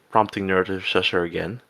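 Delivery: ordinary delivery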